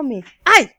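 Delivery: sharp loud yelp of pain; pained ouch. Acted. Goldilocks burning her mouth on hot soup, loud yelp. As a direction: cry out as the soup burns.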